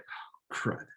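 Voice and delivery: breathy whispered dismay to himself; inner whisper. Retold or imitated. Realizing his mistake, whispering to himself in embarrassment. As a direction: barely voice it, embarrassed. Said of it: "inside, I was just like".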